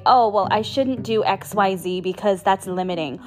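ordinary talk

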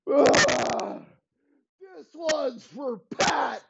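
high, shouted gunfight frenzy; manic shout. Acted. Elway shouts wildly while shooting Pat. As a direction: yell it like you're firing.

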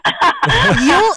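rising amused laugh; a quick laugh at herself; light laugh. Real, not acted. Laughs after parenting joke.

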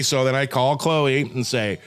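normal talking voice

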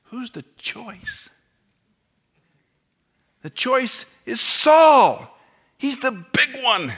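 high, slow, drawn-out mock voice. Preacher mocking Israel's choice of Saul, high and slow. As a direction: stretch it out in sarcasm.

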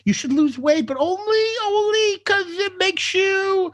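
very high, drawn-out singsong mockery; mocking advice-givers. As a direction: imitate preachy people in a high, sappy voice. Mocking what people say about weight.

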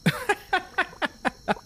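shrill burst of mercenary laughter; high mocking laugh. Mercenaries laughing at the paladin's boast. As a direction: cackle at the hero.

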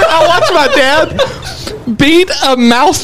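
high, laughing through words. Real, not acted. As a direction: giggle through the whole line. Laughing while telling story.

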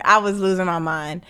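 ordinary talk

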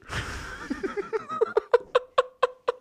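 high, far-off burst of laughter; big laugh. Real, not acted. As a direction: let out a big cackling laugh. Laughs at his own remark.

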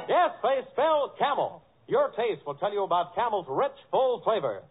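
usual speaking voice

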